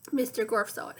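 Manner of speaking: usual speaking voice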